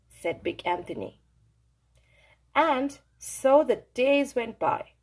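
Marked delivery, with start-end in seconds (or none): none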